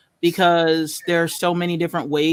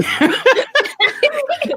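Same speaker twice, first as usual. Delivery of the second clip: high squealing giggle; giggle, flustered and caught out; high embarrassed giggle; 3 dB louder than usual. Real, not acted. Embarrassed at being called on.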